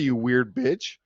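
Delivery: normal talking voice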